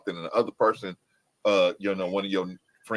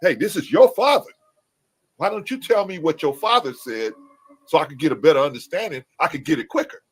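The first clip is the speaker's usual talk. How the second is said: higher, louder eager request to the son; eager stranger asking; 7 semitones higher, 6 dB louder than usual. Retold or imitated. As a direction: play an outsider politely begging for help. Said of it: "go to you and say".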